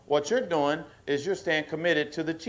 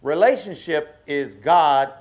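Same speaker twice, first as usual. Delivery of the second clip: louder fervent preaching, stretching key words; preaching at full force to the church; rising preaching; 5 dB louder than usual. Acted. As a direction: drive it home to the congregation.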